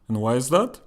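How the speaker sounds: normal talking voice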